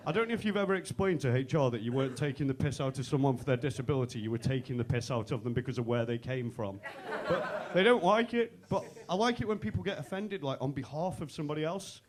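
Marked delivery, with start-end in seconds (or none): none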